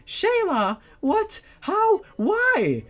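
put-on high, shocked stammering girl voice; stuttering girl. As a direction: stammer the questions out in panic. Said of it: "Roxanne stuttered".

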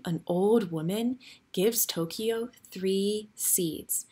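plain reading voice

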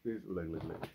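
ordinary delivery

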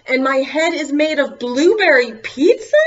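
high and loud; outraged. Pretend. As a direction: act appalled at the words. Forced silly line.

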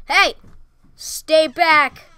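loud warning yell; shouting threat. Real, not acted. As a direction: bark it at them. Threatening with gun.

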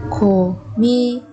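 고민이다 with gaps between syllables. Shown as a demonstration. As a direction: separate every syllable of 고민이다 for learners. Teacher models word syllable by syllable.

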